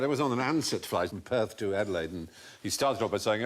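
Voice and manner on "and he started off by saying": ordinary talk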